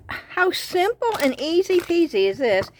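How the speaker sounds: delighted. Real, not acted. Delighted at simple craft idea.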